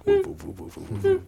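high yappy woofs; dog barking. Acted. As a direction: bark like a puppy. Barking for the team.

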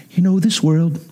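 low, really calm painter impersonation; easygoing, low-pitched TV art teacher; Bob Ross. Retold or imitated. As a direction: imitate a soothing, simple painting host. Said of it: "That's my best Bob Ross impersonation".

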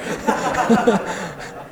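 breathy laugh after the punchline; airy laugh. Real, not acted. Preacher laughs after telling the joke, airy laugh.

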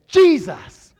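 high, loud cry of the name; loud prayer cry. Retold or imitated. Preacher grabbing the realtor's arm, crying out loud. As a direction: shout it like a blessing. Preacher prays loudly over her.

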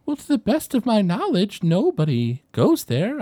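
put-on a polite old lady thinking it over. GM voicing the old woman.

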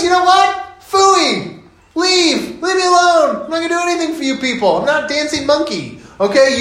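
exasperated Jesus. Retold or imitated. Jesus refusing the Pharisees' demand, fed up and high. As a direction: blow up like a man pushed too far. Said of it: "And he goes".

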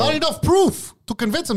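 high, mock official reading. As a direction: read it out like a report. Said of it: "That's what they wrote".

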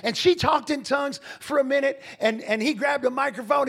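usual speaking voice

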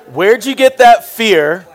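the sermon's big question shouted out; emphatic preaching. Acted. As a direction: hurl the question at the congregation. Preacher hammering the key question.

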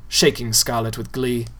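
usual speaking voice

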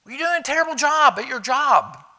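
inner accusing voice. Retold or imitated. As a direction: play the voice that condemns you.

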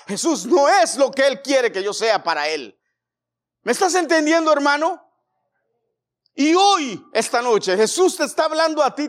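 loud, high preaching, building to shouts; fiery preaching. Acted. Pastor urging the brothers to respond, fiery. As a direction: rouse the church, rising to a shout.

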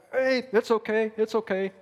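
high, loud, bright reassurance to himself; cheery self-reassurance. Retold or imitated. After the steep hill, telling himself it's fine, loud and high. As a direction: talk yourself up, loud and high. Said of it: "but I'm like".

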